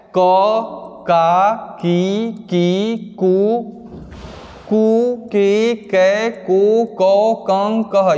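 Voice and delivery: slow schoolroom drill, each syllable held long; syllable-chart recitation. Shown as a demonstration. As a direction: recite क, का, की one by one, drawn out with pauses. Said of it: "ये है बारह खड़ी". (बारहखड़ी का लंबा, लयबद्ध पाठ)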